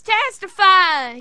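catchphrase shout. Retold or imitated. Voicing Devon's catchphrase.

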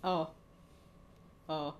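ordinary talk, nothing marked

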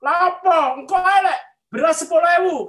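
high, loud mocking refusal; scornful retort. Big electronics shop owner answering an invitation, scornful retort. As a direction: imitate a shop owner scoffing loudly. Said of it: "dia bilang".

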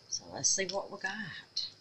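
whispered anticipation; a quiet, eager reveal. Real, not acted. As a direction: hush it as you unmold. Hushed reveal of the molds.

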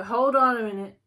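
loud sudden halt to yourself; firm self-check. Pretend. Reader to listener, halting a rushed crush, loudly. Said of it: "take a step back and go".

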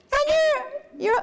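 high little pig voice taunting; yelling pig. Acted. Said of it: "the third little pig yelled".

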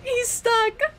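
high gleeful exclaim; real thrilled yelp; excited cry. Real, not acted. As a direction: squeal with relief. Boss gets stuck.

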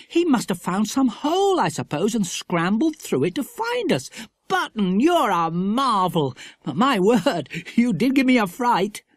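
put-on high, excited child's dialogue; amazed Philip, fond. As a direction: marvel at a clever pet.

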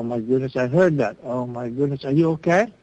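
ordinary talk

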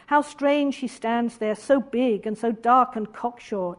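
slow, lingering reading of the quote; said slowly. Acted. Lecturer reading Lady Chatterley's words, slow. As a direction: draw each word out, unhurried. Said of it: "she said slowly".

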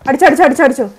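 loud urgent yell to shoot now; urgent shout. Real, not acted. Streamer yelling at her squadmate in a firefight, urgent shout. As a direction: fire it off at a teammate, fast and loud. Urging teammate mid-fight.

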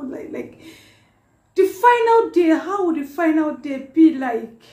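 high, loud, overwhelmed with emotion; real tearful outpouring. Real, not acted. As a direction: pitch climbing as the tears take over. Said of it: "I could not hold back the tears".

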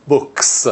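بوکس with a crisp unvoiced s at the end; final s shown. Shown as a demonstration. Teacher demonstrating final s in بوکس. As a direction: sound out بوکس as boks, hissing the s. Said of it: "ک، س".

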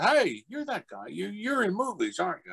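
high-pitched voice of recognition; imitated curious stranger. Stranger at hotel pool recognizes actor, high questioning voice. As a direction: accost him in a high fan voice. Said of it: "And a guy goes".

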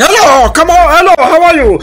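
high, loud mock French; hammed-up French accent greeting; weird French monster. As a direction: call out in a silly French voice. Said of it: "it's like a weird French".

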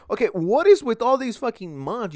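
loud, high, fed-up outburst; exasperated outburst. Real, not acted. To the viewers about all these months, loud exasperated outburst. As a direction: throw your hands up at it, loud and high.

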